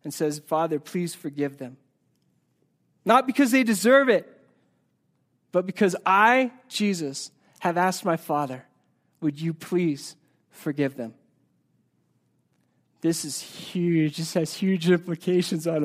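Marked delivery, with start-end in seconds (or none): 0.4-1.8 soft, tender prayer; hushed compassionate prayer; compassionate plea. Acted. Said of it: "Jesus has love and compassion". 9.2-11.1 gentle plea. Acted. Voicing Jesus asking the Father.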